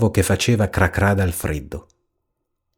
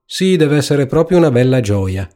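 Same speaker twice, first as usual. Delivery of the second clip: higher-pitched mock agreement; ironic; 6 semitones higher than usual. A narrator's reading. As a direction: feign delight with light irony. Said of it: "disse la gallina ironicamente". (con tono ironico)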